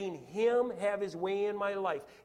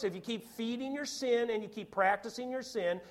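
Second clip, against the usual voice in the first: ordinary delivery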